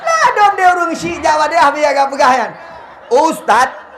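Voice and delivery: high and loud, laughing; excited laughing outburst. Real, not acted. (seru sambil tertawa, suara tinggi)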